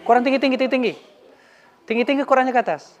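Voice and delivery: high, loud commands to lift it up; calling out. Real, not acted. Teacher directing kids to lift Quran.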